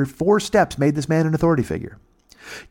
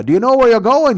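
mock Mountie call; 11 semitones higher, 7 dB louder than usual. Mountie voiced in story.

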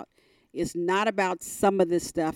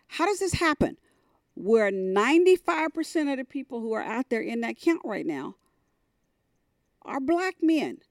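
disbelieving cry; 7 semitones higher than usual. Retold or imitated. Niece retells her shock at the prison, in high disbelief. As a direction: pitch climbs high on the repeated question.

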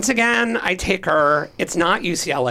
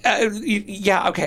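normal talking voice

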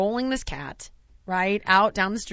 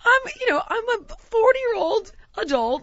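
high, loud mock outrage; hammed-up indignant shouting; indignant retort; 12 semitones higher, 8 dB louder than usual. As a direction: yell it in exasperated protest. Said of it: "So I was like".

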